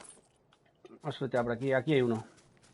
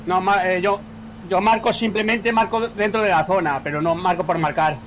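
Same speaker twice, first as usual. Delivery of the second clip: loud protest; 4 semitones higher, 8 dB louder than usual. Real, not acted. Defending himself in argument.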